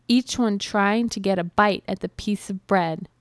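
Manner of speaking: plain reading voice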